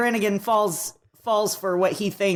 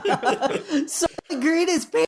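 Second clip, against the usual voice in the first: higher-pitched, hammed-up movie line; mock dramatic cry; 9 semitones higher than usual. Friend quotes a film as a joke, mock dramatic cry.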